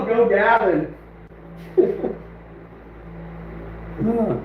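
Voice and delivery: usual speaking voice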